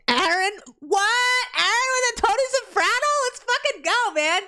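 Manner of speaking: high, loud, thrilled yell; excited shout. Real, not acted. Streamer yells thanks to Aaron for the Tony Soprano membership, thrilled. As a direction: shout for joy at the new member.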